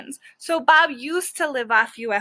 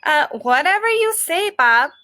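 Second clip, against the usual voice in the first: prissy squirrel; 5 semitones higher, 5 dB louder than usual. Acted. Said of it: "He's quite the prissy eater, so he's like".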